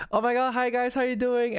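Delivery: high, bubbly hello. Retold or imitated. Streamer imitating own normal stream opening, high-pitched greeting. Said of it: "normally in my streams, you just hear me like".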